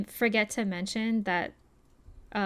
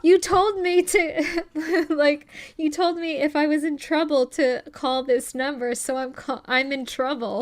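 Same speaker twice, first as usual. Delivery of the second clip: lightly voiced girl protesting, pitched up; mock pleading girl; 6 semitones higher, 6 dB louder than usual. Retelling the girl's answer to her attacker, mock pleading. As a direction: imitate an indignant plea through amusement.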